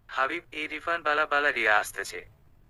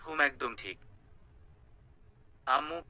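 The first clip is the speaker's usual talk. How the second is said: plain reading voice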